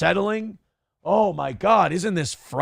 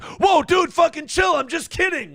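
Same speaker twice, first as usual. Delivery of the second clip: panicked bro; 13 semitones higher than usual. Retold or imitated. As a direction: yelp it like a scared frat guy. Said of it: "he's like".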